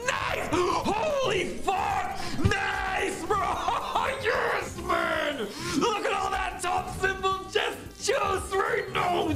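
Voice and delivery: high, shrieking shouts of joy; shrieking jackpot winner. Real, not acted. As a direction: yell in delight at a huge win. Big slot win on stream.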